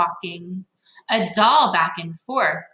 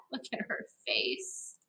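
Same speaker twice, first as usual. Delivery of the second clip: whisper; 3 semitones higher, 15 dB quieter than usual. Real, not acted. Aside to children.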